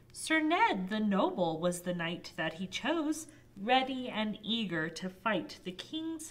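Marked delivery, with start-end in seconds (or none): none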